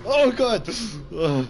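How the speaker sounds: high, loud startled yell; frightened scream. Real, not acted. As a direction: shriek it in sudden fright. Sudden scare from monster.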